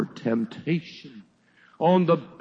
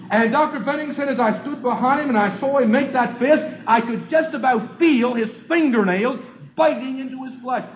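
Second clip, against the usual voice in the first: high, loud revival preaching, driving the story forward; fiery preaching; 11 semitones higher, 6 dB louder, faster than usual. Acted. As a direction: preach it at full force to the congregation. Preacher tells story at full force.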